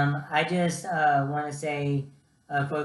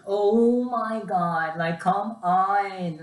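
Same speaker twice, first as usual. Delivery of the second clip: slow, higher, drawn-out impatience; a hint of a fed-up nurse; exasperated nurse; 4 semitones higher, 3 dB louder, much slower than usual. Nurse tired of the nervous patient, mock exasperated.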